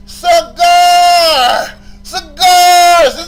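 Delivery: loud, drawn-out repeated bellow; emphatic shouting. Real, not acted. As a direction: build to a high, stretched shout. Angry rant, repeating one word.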